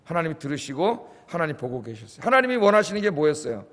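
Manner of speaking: ordinary delivery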